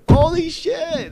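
very high, loud, drawn-out exclamation; stretched high-pitched burst of excitement; real amazement. Real, not acted. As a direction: squeal it in amazed delight. Thrilled recalling the moment.

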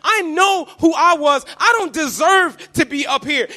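loud, high, overjoyed cry; excited shouting. Pretend. Preacher voicing himself arriving in heaven, shouting with joy. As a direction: shout your gratitude upward. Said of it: "so excited and so giddy to say".

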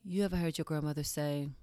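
usual speaking voice

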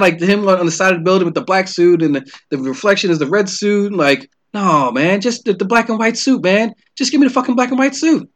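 ordinary talk, nothing marked

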